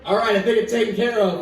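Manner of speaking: ordinary talk, nothing marked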